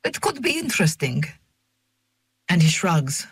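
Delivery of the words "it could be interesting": put-on higher, softer, lilting accented English; sing-song English. A narrator's reading. Old man considers her job offer in sing-song English. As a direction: let his English rise and fall like a tune. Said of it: "he says in his sing-song English".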